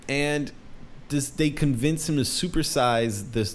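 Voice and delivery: usual speaking voice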